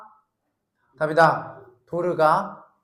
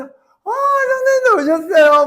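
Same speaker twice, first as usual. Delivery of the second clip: bright greeting; 13 semitones higher, 6 dB louder, faster than usual. Pretend. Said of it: "막 이러면서 하트 막 이거 막 날려주고".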